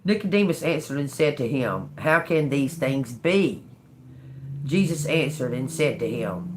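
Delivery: measured Bible reading voice; scripture reading. Acted. Reading John to viewers, steady scripture reading. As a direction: read the verses steady and earnest.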